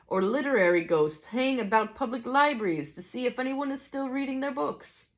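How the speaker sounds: high, lilting amateur Scots; animated Scottish character voice; Scottish teacher. Acted. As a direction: explain as a storybook Scotsman, pitched well up.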